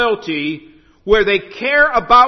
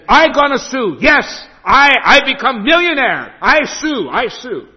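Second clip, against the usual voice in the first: excited broken English; 5 semitones higher, 3 dB louder than usual. Retold or imitated. Husband excited about becoming millionaire.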